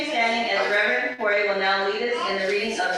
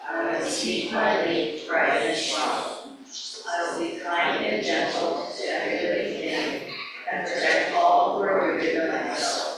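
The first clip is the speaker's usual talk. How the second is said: recited pledge; 3 semitones lower than usual. Acted. Leader reciting the pledge to the congregation, prayerful recitation.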